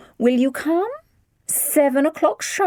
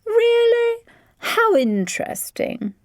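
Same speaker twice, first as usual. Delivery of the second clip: uninterested; slower than usual. A narrator's reading. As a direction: fake interest, clearly bored. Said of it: "sounded anything but interested".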